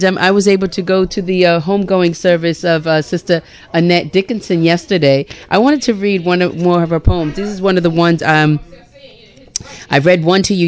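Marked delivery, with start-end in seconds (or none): none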